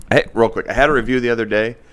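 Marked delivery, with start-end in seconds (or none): none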